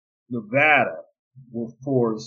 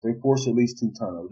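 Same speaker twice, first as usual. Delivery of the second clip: ordinary talk, nothing marked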